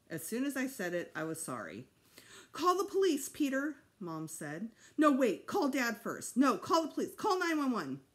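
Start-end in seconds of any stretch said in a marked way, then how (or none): 2.5-3.8 loud, high urgent order; frantic mother calling for help; panicked. A narrator's reading. Mother ordering son to phone, panicked. 5.0-8.0 loud, high, frantic orders; flustered, urgent, changing course; panicked. A narrator's reading. Mother giving son frantic orders, panicked.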